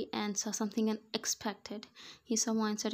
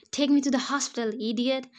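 pained yell; 4 semitones higher, 9 dB louder, faster than usual. A narrator's reading. Wife in labour yelling.

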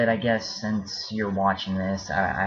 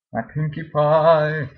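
much higher, louder, slow gushing cry; excited squeal; 8 semitones higher, 8 dB louder, slower than usual. Real, not acted. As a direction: gush over a favorite toy. Excitedly showing a favorite plush.

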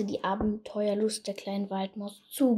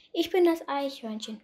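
ordinary delivery

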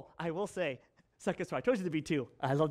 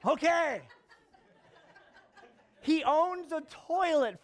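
real laugh on the word, pitched up high; laughing okay; 9 semitones higher, slower than usual. Real, not acted. Father reacts to toilet builder, laughing high.

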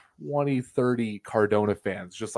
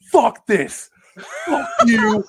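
imitated furious yell, climbing much higher; enraged shouting; 8 dB louder, slower than usual. Fan throwing things after the pinfall, enraged imitated shout. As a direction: blow up like an outraged fan.

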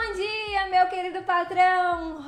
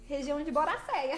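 ordinary talk, nothing marked

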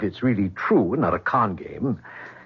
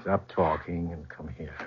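slow, much softer, low command; 6 semitones lower, 9 dB quieter than usual. Acted. Boss softly ordering his secretary to come close. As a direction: hush her and pull her in.

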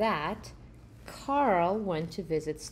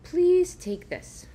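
slow, high, pressing the gift on him; earnest offer; 10 semitones higher, 7 dB louder, slower than usual. Acted. Uncle gives the robber his robe, slow and earnest. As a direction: hold it out and insist, slowly.

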